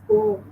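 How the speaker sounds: poem in two syllables, "po-em"; poem with a clear long O, then "em"; correct pronunciation. Shown as a demonstration. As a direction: model poem as PO-em. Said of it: "Two syllables".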